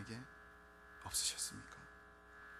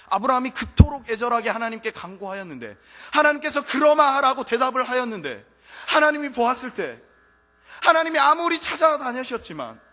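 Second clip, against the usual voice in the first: loud, high, impassioned preaching; preaching at full force; 12 semitones higher, 20 dB louder than usual. Acted. Preacher crying out to the congregation, full force. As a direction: cry it out to the congregation.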